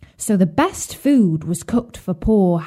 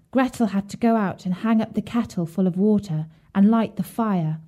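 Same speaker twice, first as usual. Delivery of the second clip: usual speaking voice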